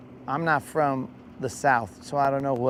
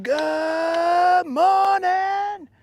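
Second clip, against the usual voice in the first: much higher, louder, very slow sing-song hello; drawn-out greeting; 6 dB louder, much slower than usual. Real, not acted. Greeting a viewer named Sassin, with a much higher, slow, sung hello. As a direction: stretch the greeting out like a happy call.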